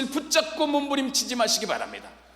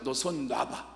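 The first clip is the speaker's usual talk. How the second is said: lower, softer voice of God; 8 semitones lower, 7 dB quieter, slower than usual. Retold or imitated. God telling the man to let go, low and soft. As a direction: give the command quietly from above.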